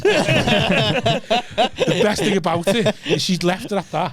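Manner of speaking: slow, high, laughing delivery; laughing through it. Real, not acted. Telling friends the best bit, laughing through it. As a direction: laugh the words out slowly.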